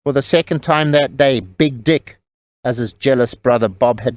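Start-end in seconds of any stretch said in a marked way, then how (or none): none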